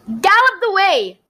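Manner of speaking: high, loud rallying call; rally everyone forward; girl with a sword cheering the charge; eager battle cry. A narrator's reading.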